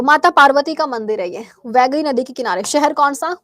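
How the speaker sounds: normal talking voice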